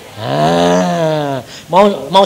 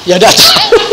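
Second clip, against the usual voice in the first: put-on high, laughing girl's voice; squeaky laughing daughter; daughter's voice; 7 dB louder than usual. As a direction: play the clueless daughter, giggling.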